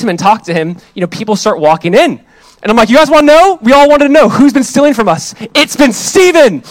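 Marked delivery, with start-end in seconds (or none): 2.9-6.6 loud, high announcement to the whole room; public accusation. Retold or imitated. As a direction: expose the culprit, drawing out the final reveal. Exposes thief to the group.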